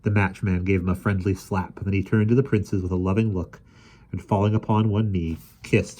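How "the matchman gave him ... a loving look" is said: usual speaking voice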